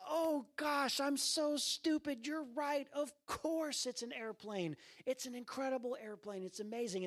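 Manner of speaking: high-pitched put-on enthusiasm, easing down toward the end; gushing dad. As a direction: imitate a father gushing over his child's creation. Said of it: "trying to, like, get excited about it".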